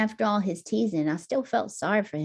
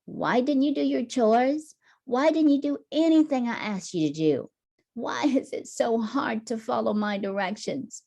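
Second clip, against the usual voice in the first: scolding mom; 6 semitones higher than usual. Acted. Mom scolding Tommy over undone chores, exasperated. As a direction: nag him about the chores.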